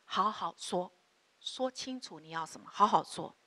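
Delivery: quiet and level, no anger; calm firm telling. Shown as a demonstration. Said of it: "你要跟他说".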